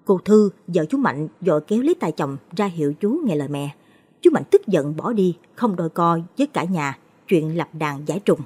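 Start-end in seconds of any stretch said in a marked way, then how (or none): none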